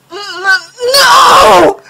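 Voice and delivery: a panicked, breaking shriek of denial; stammered shriek. A narrator's reading. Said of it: "half stammered, half shrieked".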